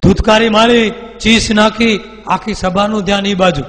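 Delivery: high and loud, building the shriek; dramatic telling. A narrator's reading. Storyteller describing the demon's shriek, loud and high. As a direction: paint the scream for the crowd.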